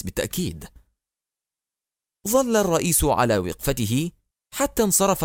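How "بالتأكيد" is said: much lower and softer, fading "بالتأكيد"; muttered repeat. Acted. Minister muttering "بالتأكيد" again while leaving the room. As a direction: drop "بالتأكيد" to a mutter as you walk away. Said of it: "غمغم الوزير".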